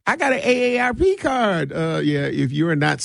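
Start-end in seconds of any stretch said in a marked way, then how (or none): 0.1-1.7 high mock pleading voice; mock older attendee. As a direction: imitate someone arguing their age. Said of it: "you be like".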